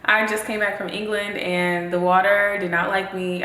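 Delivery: usual speaking voice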